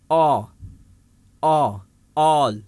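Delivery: all built up from a repeated, separate "aw"; AW sound. Shown as a demonstration. Teacher models the A in all, spaced AW. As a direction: say all with a long AW vowel. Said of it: "اے کی ہم کیا آواز نکال رہے ہیں".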